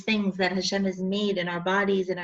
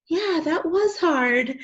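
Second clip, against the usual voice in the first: slow, higher sigh of relief after a gasp; relieved acceptance; 8 semitones higher, 4 dB louder, slower than usual. Shown as a demonstration. Validating her own feelings after a hard phone call, relieved and slow. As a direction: breathe out and let it land.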